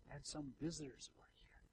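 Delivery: breathy whisper to the congregation; whispered aside. Real, not acted. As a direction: drop to a whisper for the visitors.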